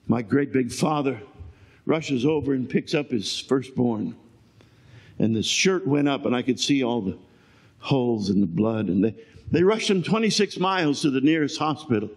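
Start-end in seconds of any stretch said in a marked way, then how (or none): none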